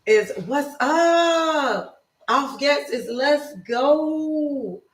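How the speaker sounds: high, slow, drawn-out teaching voice; teaching German phrases. Shown as a demonstration. Host teaching viewers German phrases, slow and drawn out. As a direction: spell out the meaning slowly for learners. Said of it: "Auf geht's and we get is what's up".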